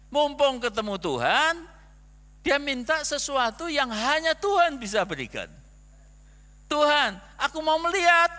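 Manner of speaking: loud, high, driving sermon delivery; the pastor presses his point about asking God, preaching loudly; full-force preaching. Acted. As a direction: preach it out with conviction. Preaching climax.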